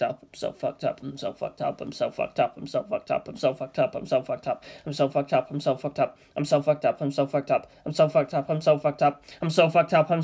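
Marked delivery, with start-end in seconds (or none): none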